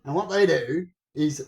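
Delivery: usual speaking voice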